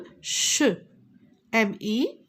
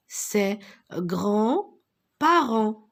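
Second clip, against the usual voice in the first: ses grands-parents read very slowly; much slower than usual. Shown as a demonstration. Teacher rereading the sentence to pupils, slowly. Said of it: "Elga, la petite fille".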